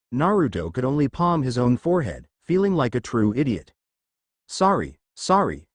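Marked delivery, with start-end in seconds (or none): none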